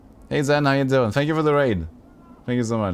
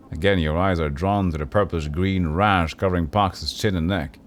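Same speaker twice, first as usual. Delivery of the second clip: normal talking voice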